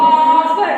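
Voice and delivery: आवर stretched loud on its opening vowel, no h; drawn-out model word. Shown as a demonstration. Teacher models the example for pupils, drawn-out word. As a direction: hold the first vowel of आवर long and loud.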